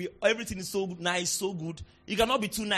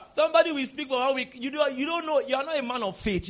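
ordinary delivery

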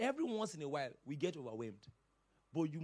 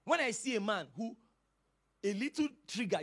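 usual speaking voice

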